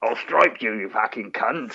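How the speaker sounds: imitating a tough London accent; hammed-up London threat. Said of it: "and you're all".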